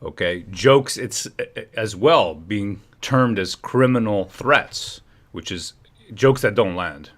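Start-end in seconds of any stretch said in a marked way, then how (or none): none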